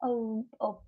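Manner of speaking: ordinary talk, nothing marked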